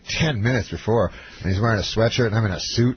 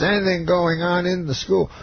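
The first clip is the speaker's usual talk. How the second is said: higher-pitched mimicked question; Bill Cosby; 6 semitones higher than usual. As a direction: ask it in a put-on, raised impression voice. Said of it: "He says".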